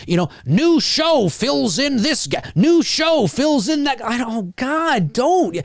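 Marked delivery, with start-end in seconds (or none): 0.4-4.0 high, slow sing-song announcer; sarcastic hype voice; mocking headline read. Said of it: "I see headlines". 4.0-5.5 pained pleading. Acted. Reacts pleadingly to headlines.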